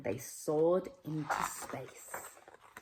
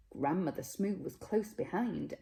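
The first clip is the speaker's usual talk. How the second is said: plain reading voice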